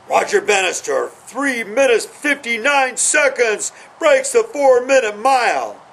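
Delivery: imagined stadium announcer, pitched high and ringing; race announcer. Retold or imitated. Runner imagines announcer calling his record mile. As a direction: call the record like a track announcer in his head.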